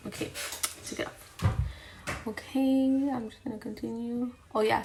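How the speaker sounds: ordinary talk, nothing marked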